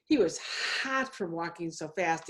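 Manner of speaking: usual speaking voice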